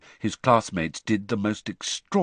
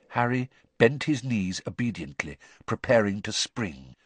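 usual speaking voice

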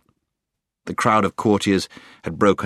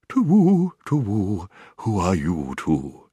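soft hooting voice; slower than usual. A narrator's reading. Owl greeting two children it has just met, soft hooting. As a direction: draw out the oo sounds like an owl. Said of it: "said in a soft, hooting kind of voice".

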